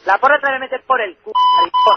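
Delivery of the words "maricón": screamed high insult; furious yell. Real, not acted.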